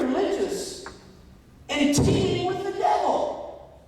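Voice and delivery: high, rising sermon fervour; preaching. Real, not acted. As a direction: preach it, building to a loud climax. Preaches at full force.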